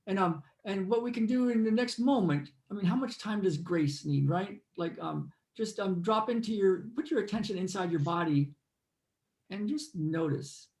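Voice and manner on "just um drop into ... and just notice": guided meditation. Acted. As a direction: lead them inward, unhurried.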